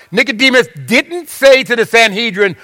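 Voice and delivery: normal talking voice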